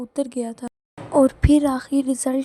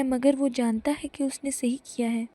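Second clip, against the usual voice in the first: plain reading voice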